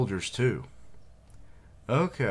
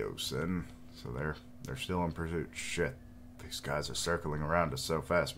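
usual speaking voice

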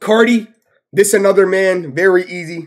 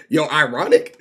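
ordinary talk, nothing marked

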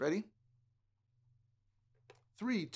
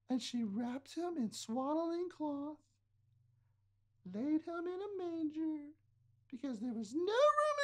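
princess voice; 9 semitones higher than usual. Acted. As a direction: float it up like a princess. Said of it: "let's all be princesses".

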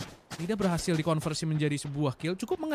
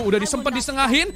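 ordinary delivery

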